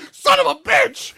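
very high, loud, drawn-out curse; exasperated shouted outburst; retold angry shout. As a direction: act out yelling after an escaping witness. Retold outburst at fleeing witness.

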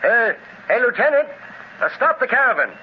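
calling out. Acted. Calling out to the lieutenant.

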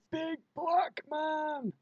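high, slow, drawn-out mock shout; imitating a shout. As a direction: stretch out a hammed-up yell.

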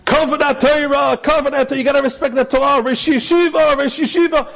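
put-on high, insistent old man's call; a lightly voiced old man urging respect. Old man calling to the crowd to make way, high and insistent. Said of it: "An old man comes and says".

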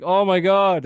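sudden high, loud startled cry; scared gasp. Retold or imitated. Retelling seeing a rat, a high startled yelp. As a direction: yelp it like you just saw a rat. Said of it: "I'm like".

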